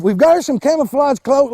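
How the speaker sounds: ordinary talk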